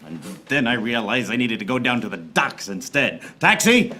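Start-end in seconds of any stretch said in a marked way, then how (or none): 3.4-3.9 shouted taxi hail, higher and louder; calling out. Acted. Detective hailing a cab, shouting.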